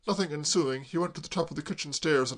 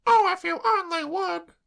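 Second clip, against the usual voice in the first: put-on much higher, slow wretched girl; tearful girl; 7 dB louder than usual. Claire sobbing to Braddock, high tearful wail.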